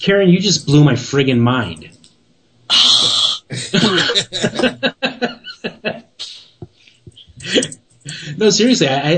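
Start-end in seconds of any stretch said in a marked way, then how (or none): 2.7-6.0 high, delighted burst of laughter; long laugh. Real, not acted. Laughs after "blew my mind.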